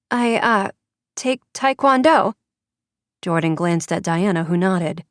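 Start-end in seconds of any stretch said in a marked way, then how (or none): 0.1-2.3 put-on higher, hesitant little girl; nervous child answering; scared young girl. A narrator's reading.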